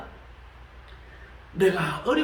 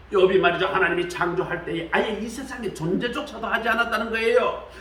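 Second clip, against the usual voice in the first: normal talking voice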